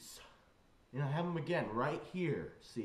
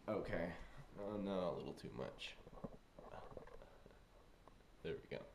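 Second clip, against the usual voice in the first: ordinary talk, nothing marked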